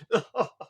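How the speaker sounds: high, loud amused laugh. Real, not acted. Reviewer amused Koichi stays silent, laughing. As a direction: burst out laughing in disbelief.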